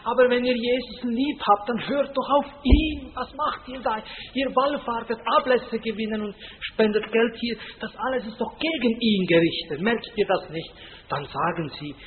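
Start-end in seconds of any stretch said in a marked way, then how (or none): 0.0-10.7 higher, louder imitation of a pleading aunt; lightly voiced elderly aunt urging her sisters; Florentina pleading. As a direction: plead earnestly with her sisters, a hint of tears. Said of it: "weinend manchmal beim Kaffeetrinken".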